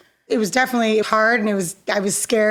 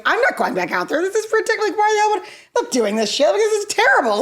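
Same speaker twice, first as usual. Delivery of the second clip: mock tearful whine, pitch jumping high; half-playful imitation of her younger, shaken self; a touch of distressed wailing; retold upset protest; 10 semitones higher, faster than usual. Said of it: "I was crying and I was like".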